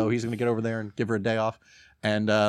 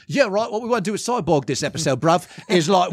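put-on British accent; 6 semitones higher than usual. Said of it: "He sounds like one of the Kingsmen".

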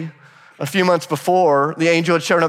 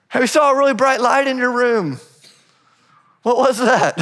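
curious parents; 8 semitones higher than usual. Retold or imitated. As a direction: play the suspicious parent checking in. Parents voiced in retold scene.

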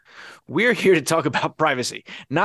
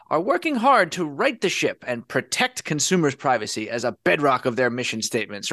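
ordinary talk, nothing marked

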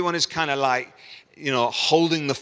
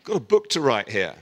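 protesting; 6 semitones higher, 4 dB louder than usual. Retold or imitated. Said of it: "I'm like".

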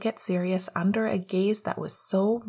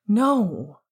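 loud shouted No; 7 dB louder than usual. A narrator's reading. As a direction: shout it over the wind. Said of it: "and then a little louder".

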